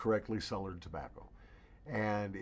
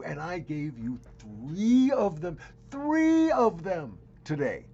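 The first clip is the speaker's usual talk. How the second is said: louder and higher, mock-grand self-praise; hammed-up bragging; 7 semitones higher, 11 dB louder than usual. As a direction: oversell the videos like a carnival barker. Hyping his own snuff reviews.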